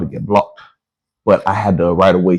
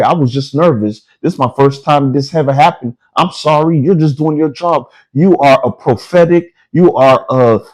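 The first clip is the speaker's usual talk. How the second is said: higher-pitched, pleading apology; hammed-up submissive begging, easing off at the end; grovelling, servile act; 3 semitones higher than usual. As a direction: flatter and apologize as if at their mercy. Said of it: "I had to act like a slave".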